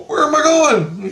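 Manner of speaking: high, loud panicked question; re-enacted high-pitched protest; retold alarm. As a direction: yelp it like you're being dragged off. Retelling his alarmed question in dream.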